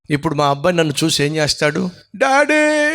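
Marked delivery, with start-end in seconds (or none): 2.2-2.9 put-on high, thin child's cry of daddy; crying little son. Son running to his father, crying child's call. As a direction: call out like a hurt small boy.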